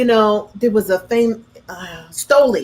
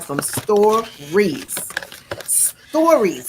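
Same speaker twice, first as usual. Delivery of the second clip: "stories" stretched slowly, syllable by syllable; drawn-out STORIES; much slower than usual. Real, not acted. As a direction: drag out "stories" for emphasis. Drags out word for emphasis.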